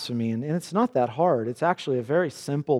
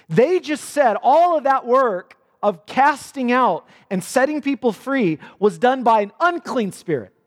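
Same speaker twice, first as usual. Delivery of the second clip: louder, higher and slow, driving it home; forceful preaching; 5 semitones higher, 4 dB louder than usual. Real, not acted. Preacher to congregation, outraged slow forceful preaching. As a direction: hammer each word of the charge.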